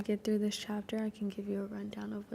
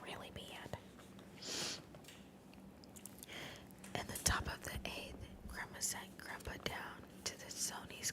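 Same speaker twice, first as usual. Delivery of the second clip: hushed, voiceless ASMR narration; whispered story reading; 13 dB quieter than usual. Acted. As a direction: read the story entirely in a whisper.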